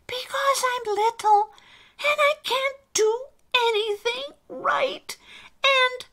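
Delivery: very high, small anxious voice; timid little character. Acted. Alistair tells the elf Santa will reject him, timid. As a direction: confess the fear in a tiny high voice.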